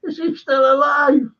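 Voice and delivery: high shouted mock question; mock shout of disbelief; retold punchline. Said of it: "Will A says".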